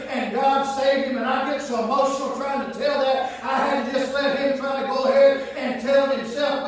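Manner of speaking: fervent preaching, building steam; stirred-up sermon voice. Acted. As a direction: preach it with mounting fire. Preacher working himself up.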